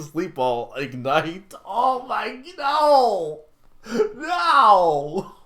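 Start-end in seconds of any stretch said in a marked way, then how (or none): 1.7-5.4 high, loud comic shriek; mock panic. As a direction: squeal it like a cartoon victim. Panicked cry played for laughs.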